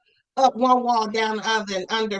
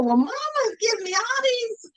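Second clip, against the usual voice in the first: daughter tattling; 9 semitones higher than usual. Retold or imitated. Retelling herself answering her father.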